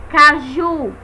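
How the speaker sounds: caju com o JU final marcado; tônica em caJU. Shown as a demonstration. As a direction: reforce a última sílaba de Caju. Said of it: "a sílaba mais forte". (caJU, acento forte no fim)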